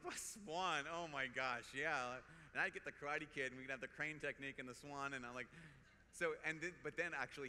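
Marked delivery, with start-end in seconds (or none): 0.0-2.2 teasing a friend, mock disbelief; playful ribbing of a friend; mocking tease. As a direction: poke fun at the swan idea. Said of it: "So I started making fun of him".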